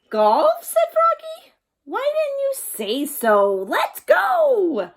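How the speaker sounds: high, loud rising excitement; surprised then eager. Acted. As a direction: jump at the idea.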